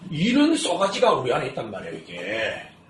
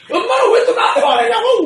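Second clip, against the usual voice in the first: mock upset child; 8 dB louder than usual. Voicing crying daughter yelling.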